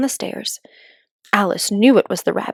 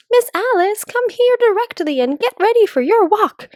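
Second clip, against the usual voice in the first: put-on much higher, bossy nurse summoning a child; stern nurse calling; 13 semitones higher, 3 dB louder than usual. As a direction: call her in like a strict nanny. Imagined nurse calling Alice.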